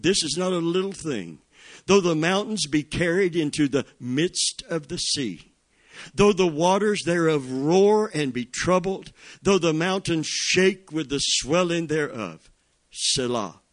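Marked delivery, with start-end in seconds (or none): 1.8-13.6 preaching scripture. Acted. Reads psalm rising in fervour.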